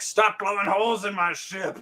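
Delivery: high-pitched indignant yell; hammed-up furious order; mock outraged shout. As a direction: yell like a fed-up villain. Outraged villain quote in recap.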